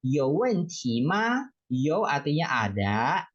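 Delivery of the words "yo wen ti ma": slow, syllable by syllable; slow teaching demonstration; slow model phrase. Shown as a demonstration. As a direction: spell out the Mandarin phrase slowly for learners. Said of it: "Ma artinya apakah".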